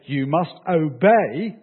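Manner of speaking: "obey" drawn out slow and loud; stress on obey. Shown as a demonstration. Preacher quotes what Paul does not say, "obey" heavily stressed. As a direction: lean hard on "obey", stretching both syllables.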